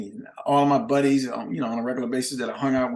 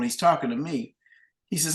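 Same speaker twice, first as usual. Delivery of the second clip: ordinary talk, nothing marked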